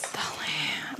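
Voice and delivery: very soft, low whisper; ASMR whisper. Pretend. Host joking about an ASMR channel, whispered. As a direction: whisper it as a mock ASMR sign-off. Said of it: "We're doing an A S M R channel".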